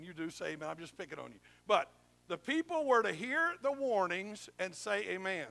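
ordinary delivery

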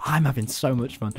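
ordinary talk, nothing marked

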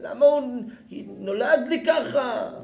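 loud, high, drawn-out rebuke; fiery preaching. Acted. Preacher mocking parents who blame the child, loudly. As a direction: challenge the listener, rising and slow.

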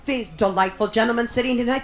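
ordinary talk, nothing marked

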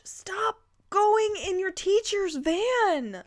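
high whisper, slow; breathy whisper. Acted. Reader voicing the boys, slow high whisper. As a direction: whisper the line, slowly and high.

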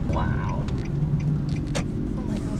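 awed whisper. Real, not acted. Awed whisper at crowded town.

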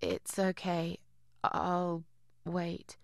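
soft, hesitant refusal; reluctant. Acted. Visitor declining an invitation to come in, reluctant and quiet. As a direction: back out quietly, pausing between words.

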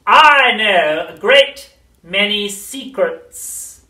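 high, very slow, coaxing crone; luring witch voice. A narrator's reading. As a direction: lure the child in slowly. Grandma luring.

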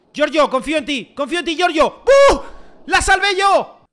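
loud plea bursting into high yell; excited shouting. Real, not acted. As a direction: build from urging to a triumphant shout. Urging keeper, then celebrating save.

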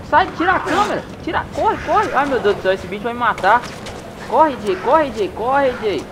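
high frantic yelling; panicked, urging a friend to run; being chased. Real, not acted. Fugindo do leopardo. (gritando em pânico, fugindo)

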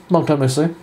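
ordinary delivery

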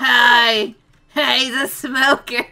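character voice. Retold or imitated. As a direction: shout it like the game voice. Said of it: "He goes".

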